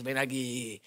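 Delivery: low soft command; low come here; boyfriend voice. Retold or imitated. Boyfriend calling girlfriend over, low. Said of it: "gastarme unas bromas".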